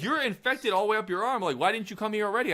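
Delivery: high, fast mock nurse scolding. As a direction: squeak it like an exasperated nurse. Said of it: "They're like".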